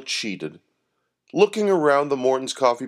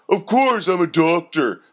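smug thug; 7 semitones higher, 4 dB louder than usual. A narrator's reading. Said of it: "said the first thug".

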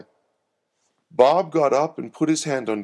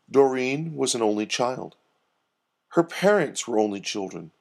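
ordinary delivery, nothing marked

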